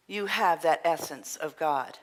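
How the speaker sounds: ordinary talk, nothing marked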